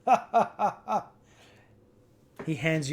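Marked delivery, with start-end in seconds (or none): 0.1-1.1 hearty barkeep laugh; bartender laugh. Acted. Bartender after his grim joke, hammed-up laugh.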